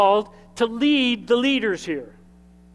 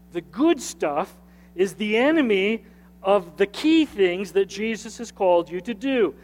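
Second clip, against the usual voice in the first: normal talking voice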